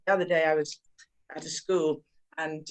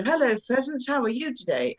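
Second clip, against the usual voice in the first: high, sing-song greeting to the trees; playful dad talking to nature; 6 semitones higher than usual. Retold or imitated. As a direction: chirp hello to the trees like a cheerful dad. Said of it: "And then he'd go".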